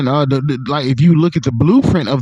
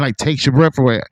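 ordinary talk

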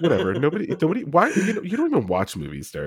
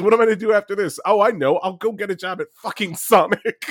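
retold line pitched up in a higher voice; higher mock resigned rant; 7 semitones higher than usual. Retelling Ike's CIA agent line, higher mock resignation. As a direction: play it higher, deadpan defeat. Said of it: "he goes".

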